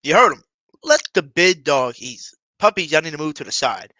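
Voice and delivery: loud, forceful pep-talk echo; emphatic echo. Retold or imitated. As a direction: hammer the message home. Hammering home the speech's message.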